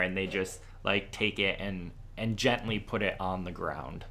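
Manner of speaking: usual speaking voice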